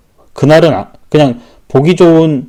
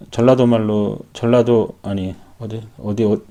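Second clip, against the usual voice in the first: ordinary talk, nothing marked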